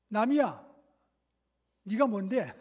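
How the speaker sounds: loud, indignant comeback; defiant retort. Acted. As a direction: push back hard at the critic. Acted defiant retort to scolding. (쏘아붙이는 반항조)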